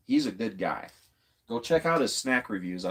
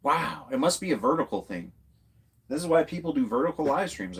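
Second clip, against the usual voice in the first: ordinary talk, nothing marked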